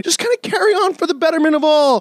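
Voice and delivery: ordinary talk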